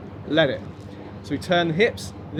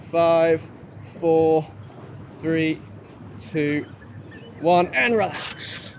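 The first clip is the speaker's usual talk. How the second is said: counting down; much slower than usual. Real, not acted. Coach counting down a hold.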